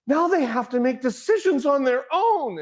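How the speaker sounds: high, hushed amazement; breathless wonder at the thought; awed realization. Retold or imitated. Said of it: "I was immediately in that world of".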